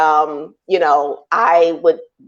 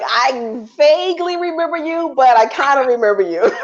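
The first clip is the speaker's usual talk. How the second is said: laughing; 8 semitones higher than usual. Retold or imitated. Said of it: "and I'm like".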